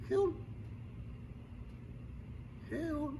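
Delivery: limp, half-hearted mock cry; feeble call. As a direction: wave a little flag and barely call. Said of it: "No, un grito desesperado". (llamado débil, sin ganas)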